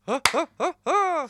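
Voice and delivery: loud, high honking inhaled laugh; Jimmy Carr laugh. Acted. Host mimics an affirming comedian, with loud high laughs. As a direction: imitate a comedian's gasping laugh, full force. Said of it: "Jimmy Carr in certain cultures is a, a very affirming person".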